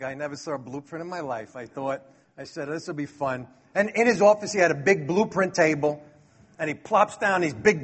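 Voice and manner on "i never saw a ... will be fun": much softer, said under the breath to himself; a hushed inner thought, barely voiced; muttered aside. Private thoughts in the interview.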